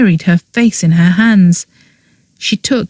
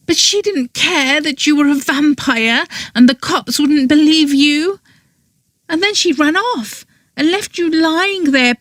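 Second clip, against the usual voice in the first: put-on higher girl voice, scornful of Lily; bitter anger; 8 semitones higher than usual. A narrator's reading. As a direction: drag out the last words bitterly. Girl's bitter account of Lily.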